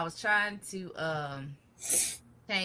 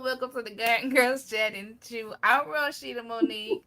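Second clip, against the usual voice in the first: normal talking voice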